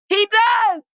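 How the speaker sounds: high, laughing concession. Real, not acted. Laughing as she admits her slip. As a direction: give in through a laugh.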